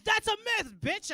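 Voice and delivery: high, loud sassy snap; sassy comeback. Retold or imitated. Comic snapping back at lady who questioned Canadians. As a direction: fire it back with attitude. Mouthing off to heckler.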